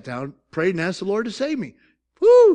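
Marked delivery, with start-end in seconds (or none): 2.2-2.5 high, loud exultant woo; sudden jubilant whoop; joyful whoop. Real, not acted. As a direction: shout for joy. Celebrating his salvation.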